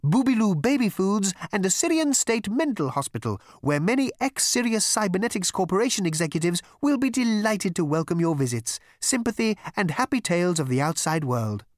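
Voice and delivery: high, bright-toned elevator spiel; chatty elevator. Acted. Elevator pitching destinations to visitors, high obliging voice.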